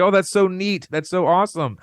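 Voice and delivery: mock-gushing admiration. Manager to left-handed diner, imitated gushing.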